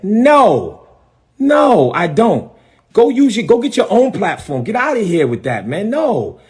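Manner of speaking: high, emphatic brush-off; retold indignant refusal. Pastor retelling his refusal to a network, indignant brush-off. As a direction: punch each "no" high and send them packing.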